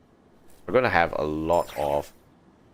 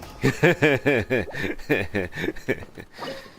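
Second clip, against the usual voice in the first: higher, louder laughing fit; real laughter; 7 semitones higher, 4 dB louder than usual. Real, not acted. Gamer cracking up after an easy kill, laughing. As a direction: laugh it off freely.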